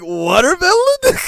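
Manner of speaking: can't believe that's her name; high-pitched mock-disbelief Watermelon?; incredulous. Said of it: "It was like".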